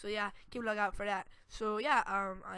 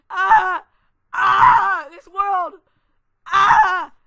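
high, loud mock wail; shrieking mom imitation; 10 semitones higher, 23 dB louder, much slower than usual. Boy mocking the mom who shrieks at the kids. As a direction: wail it like the furious mom, overdone. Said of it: "she was all like".

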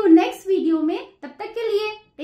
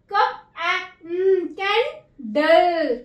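Candle split slowly as कैं-डल; slow sounding out; much slower than usual. Shown as a demonstration. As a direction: draw candle out syllable by syllable. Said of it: "अपनी short sound देगा". (Candle को धीरे धीरे टुकड़ों में बोलिए)

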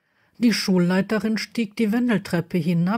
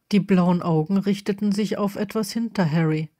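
usual speaking voice

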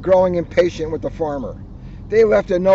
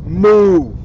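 long, loud mooing; cow moo; 9 dB louder than usual. Acted. Reading to kids, voicing the cows: long loud moo. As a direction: bellow it like a cow.

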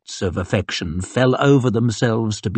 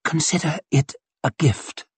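higher, hushed lady's voice; lightly voiced, slightly hoarse lady; slightly hoarse murmur; 5 semitones higher, 4 dB quieter than usual. A narrator's reading. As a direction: murmur it with a hint of huskiness. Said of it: "in a voice slightly hoarse".

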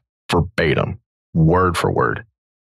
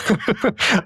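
high embarrassed laugh; 13 semitones higher than usual. Real, not acted. Laughs at own memory.

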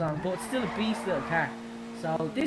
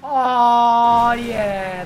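triumphant; 10 dB louder than usual. Real, not acted. Lands the jump.

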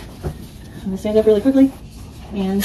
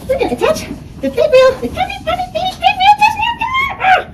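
straining high voice. Real, not acted. Straining with the rail.

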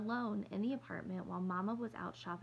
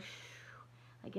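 soft unvoiced hush; shushing; 10 dB quieter than usual. Mother coming home shushing the girls, whispered. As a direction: hush the room after a rough day. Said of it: "probably going".

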